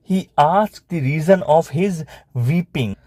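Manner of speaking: slow words, pause before the last; slow dictation. Shown as a demonstration. Teacher repeating the story sentence to pupils, slow dictation. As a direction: repeat it slowly for note-takers.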